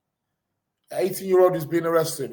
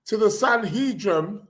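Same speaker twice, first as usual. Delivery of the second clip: ordinary delivery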